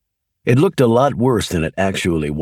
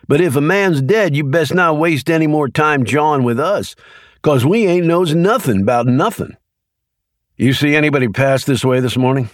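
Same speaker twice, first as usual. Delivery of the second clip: Leroy, easygoing country man; 6 semitones higher than usual. A narrator's reading. Leroy telling FBI agents his daily routine, folksy and unbothered. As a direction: play it easy and homespun, nothing to hide.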